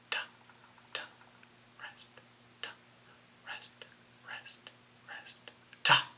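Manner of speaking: near-silent whisper keeping the beat; just breathe the rests in time; whispered rhythm. Shown as a demonstration. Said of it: "You try it by yourself now".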